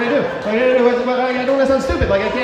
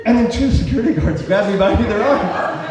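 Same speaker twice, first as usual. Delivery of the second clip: usual speaking voice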